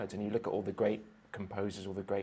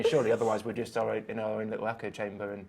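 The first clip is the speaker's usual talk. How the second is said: ordinary talk, nothing marked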